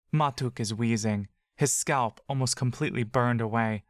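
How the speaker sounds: usual speaking voice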